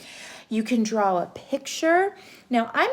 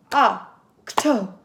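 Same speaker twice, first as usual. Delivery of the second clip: clapping syllables; 3 dB louder, slower than usual. Shown as a demonstration. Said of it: "we could do our syllables".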